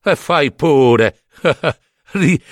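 slow mocking permission ending in a laugh; amused, drawn-out scoff with a chuckle. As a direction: let him go ahead, laughing at him. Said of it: "dissero ridendo".

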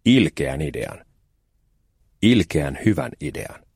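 drawn out slowly, savouring each word; slow wicked relish. A narrator's reading. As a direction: let the wicked idea sink in slowly. Narrator savours a wicked idea.

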